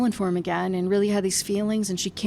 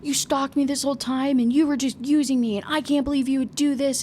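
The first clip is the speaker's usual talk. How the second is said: a little higher, half-hearted retold yelling; mild mock yell; 6 semitones higher than usual. Heroine accusing the hero of killing her father, in a mild mock yell. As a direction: lightly act out her accusing tirade. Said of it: "yelling at him".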